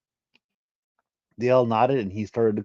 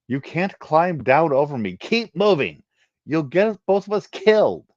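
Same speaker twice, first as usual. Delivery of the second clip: higher, louder urging; urgent; 7 semitones higher, 4 dB louder than usual. Acted. Genesis urges the Ashara to keep climbing, high and tense. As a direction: push her on, tense and high.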